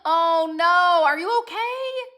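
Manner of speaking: high, drawn-out dismayed sympathy; loud "oh no" easing into a slow, high question; alarmed concern. Real, not acted. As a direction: wail it with concern for a friend. Concern for friend whose basement flooded.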